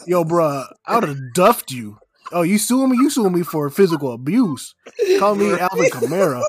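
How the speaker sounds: loud, jokey street threat; mock tough threat. Teller snapping back at the suing robber, loud mock threat. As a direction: bark it like a tough guy.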